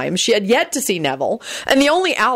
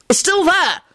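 indignant protest; 13 semitones higher than usual. A narrator's reading. Friend protests at botched document.

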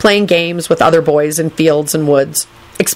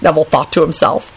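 ordinary delivery, nothing marked

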